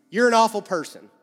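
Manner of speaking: loud accusing. Pretend. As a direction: shout the accusation.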